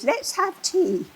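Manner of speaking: high, slow, proper English offer; English colleague. Retold or imitated. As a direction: offer tea like a kindly Englishwoman. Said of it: "she's English like me".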